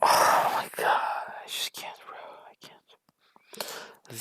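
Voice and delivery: breathy, slow, half-sobbing groan of defeat; exasperated despair. Real, not acted. Vlogger giving up on the speech, groaning in despair. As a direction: at the end of her rope.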